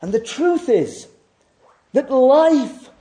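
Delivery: high, loud, driving pulpit voice; fervent sermon climax; full-force preaching. Acted. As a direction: hammer each "must be" home. Preacher rises again fervently.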